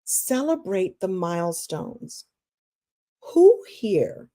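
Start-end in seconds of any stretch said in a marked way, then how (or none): 0.0-2.2 slow, softer, with a deep breath first. Shown as a demonstration. Coach to workshop listeners, slow and soft. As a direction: breathe in, then let the words settle slowly. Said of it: "I'm gonna take a deep breath on this one".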